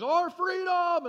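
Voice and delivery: imitated movie-hero shout, high and loud; battle cry. Hero rallying troops against the enemy, high loud shout. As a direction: cry it out as the speech's climax. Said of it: "begins to cry out and says".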